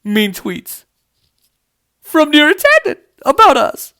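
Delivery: mock-whiny Republicans, rising high at the end; mocking whine. Mocking Republicans for viewers, mock-whiny and rising high. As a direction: whine it like a hurt senator. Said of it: "the Republicans are like".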